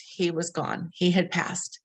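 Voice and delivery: ordinary talk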